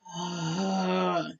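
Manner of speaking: long, falling acted sigh; resigned sigh. Cornered character before apologizing, resigned sigh. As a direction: let out a long giving-in breath.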